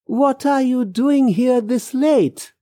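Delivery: high, slow, puzzled question; slow down and pitch it up. Acted. Man questions woman out late at night, puzzled.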